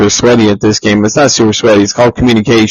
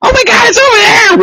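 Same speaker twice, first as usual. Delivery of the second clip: much higher, frantic shout; mock panicked yell. Mocking a friend's frantic game callout, high panicked yell. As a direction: squeal it like a lost gamer.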